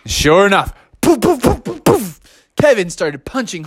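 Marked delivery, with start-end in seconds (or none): 1.0-2.2 quick, high puffed punch sounds; rapid mouth-made punch effects; imitated punch noises. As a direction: puff out a flurry of punches.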